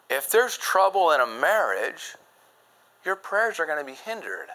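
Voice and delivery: ordinary talk, nothing marked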